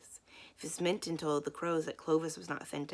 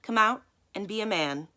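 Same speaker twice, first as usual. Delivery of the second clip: louder, higher, a firm command; loud and clear; 5 semitones higher, 7 dB louder than usual. A narrator's reading. Miss Minton calls Finn out of the cellar, loud and clear. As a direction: call down into the cellar with authority. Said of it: "she said loudly and clearly".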